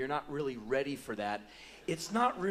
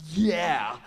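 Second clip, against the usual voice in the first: loud, higher whoop; excited fan cheer; 4 semitones higher, 8 dB louder than usual. Retold or imitated. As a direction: cheer your guitar hero. Fan cheering [name].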